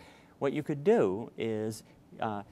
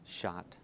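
shot said as the blended word; blending the word; 4 semitones lower, 7 dB quieter than usual. Shown as a demonstration. Child blending sounds into a word, quietly. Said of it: "Pronounce the word for me".

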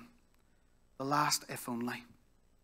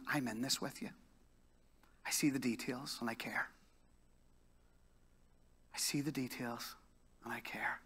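tender hush; 9 dB quieter than usual. Acted. Voicing God's tender words.

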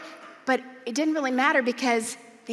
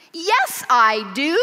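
stubborn insisting; 2 semitones higher, 5 dB louder, slower than usual. Retold or imitated. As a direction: dig in like an indignant old lady. Eleanor insists back.

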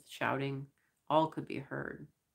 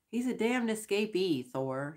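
a higher, sharp snap; snapped; 6 semitones higher than usual. Acted. Short crewman snapping at Thor, mildly angry. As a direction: cut him off with a mild snap. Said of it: "snapped the short one".

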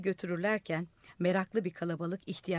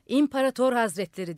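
loud, higher ceremonial call; 4 semitones higher, 8 dB louder than usual. A narrator's reading. Old man hailing the madman on the roof, loud. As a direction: hail him up on the roof. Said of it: "diye bağırdı".

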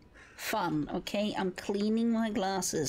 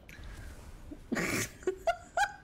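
high giggle. Real, not acted. Giggling after peas remark.

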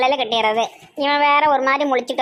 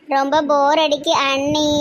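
drawn-out speech; slower than usual. Acted. Student tells sister-in-law she is bored, drawling. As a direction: drag it out, stretching the last word. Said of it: "பேச்சு என்ன இழுத்து இழுத்து".

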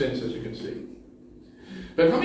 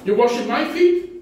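shocked Peter; 11 semitones higher than usual. Acted. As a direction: play Peter's shock at the Lord. It produced a shock.

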